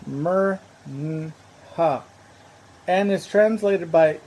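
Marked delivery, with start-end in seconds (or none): 0.1-2.0 Mer-Nha in three slow, separate pieces: mer, n, ha; spelling sounded out. Shown as a demonstration. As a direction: sound out Mer-Nha slowly, with a lone n in the middle. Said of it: "The most common spelling is".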